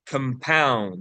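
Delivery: "compound" with the second syllable stressed, kum-POUND; verb stress on compound. Shown as a demonstration. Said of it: "the verb is compound".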